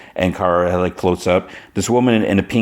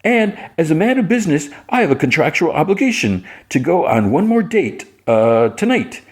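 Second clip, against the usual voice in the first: mock pompous Jimmy; 9 semitones higher than usual. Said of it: "he's like".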